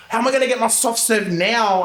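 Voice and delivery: high, put-on nasal-free whine of a local girl; whiny girl voice. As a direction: pout it like a spoilt girl denied ice cream. Glamour girl complaining about soft serve.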